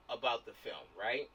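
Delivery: usual speaking voice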